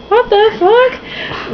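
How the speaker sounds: high, very slow, drawn-out what the fuck; stunned disbelief. Real, not acted. Reader gasping at the novel's plot, stunned. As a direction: let the disbelief stretch out in a high squeal.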